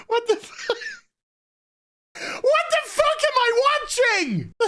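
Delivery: very high, cracking disbelief; incredulous squeal. Real, not acted. Reviewer appalled at what they are watching, high incredulous squeal.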